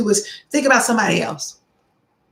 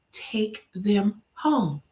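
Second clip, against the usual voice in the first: slow gentle insistence; 7 dB quieter, much slower than usual. Retold or imitated. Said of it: "I said".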